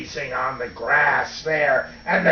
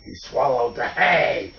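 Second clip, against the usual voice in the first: ordinary delivery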